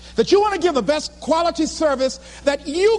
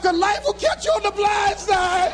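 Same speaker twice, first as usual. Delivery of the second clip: high, comic tearful preaching; heartbroken wail; 7 semitones higher than usual. Retold or imitated. Dumped speaker repeats his speech on stage, heartbroken. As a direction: wail the lesson as if freshly dumped. Same line, now heartbroken.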